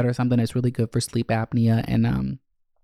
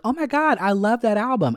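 gushing guest; 10 semitones higher than usual. Retold or imitated. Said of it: "they're like".

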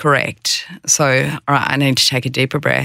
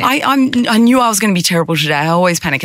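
higher, quick mock panic, half-hearted; mock panicky self-talk; 7 semitones higher, faster than usual. Mimicking a presenter's inner panic before a meeting, higher mock fret. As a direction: voice the panicking inner critic, lightly hammed. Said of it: "Not judgmentally".

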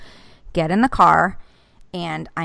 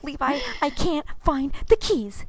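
much higher, strained desperate voice; high strained plea; 9 semitones higher than usual. Retold or imitated. Mom telling son she lost the keys, high and strained. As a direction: plead with the kid, near tears. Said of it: "I'm like".

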